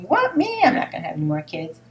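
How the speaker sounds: startled squeak dropping into fast disbelief; incredulous thought. Pretend. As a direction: act out a startled would-be parent balking. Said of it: "you may be thinking".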